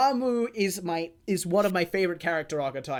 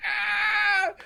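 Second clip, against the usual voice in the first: anguished cry; 12 semitones higher than usual. Retold or imitated. Retelling the hero's final scream, high. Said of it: "it's like".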